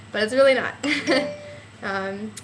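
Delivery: breathless, gasping between words; out of breath. Real, not acted. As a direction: talk while winded. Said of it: "I'm so out of breath".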